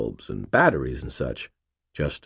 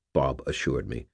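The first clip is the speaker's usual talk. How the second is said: ordinary delivery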